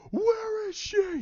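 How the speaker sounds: very high, straining scream; anguished scream. Retold or imitated. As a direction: act out a drawn-out, anguished cry. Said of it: "He's like".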